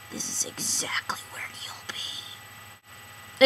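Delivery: slow, spooky whisper; ominous whisper. Acted. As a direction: whisper it like a prophecy. Ominous teasing whisper to sister.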